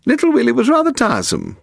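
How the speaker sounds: put-on very high, shrill old lady voice. A narrator's reading. Woman on the telephone to Paul, shrilling high. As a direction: pitch it way up and shrill. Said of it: "she shrilled down the telephone".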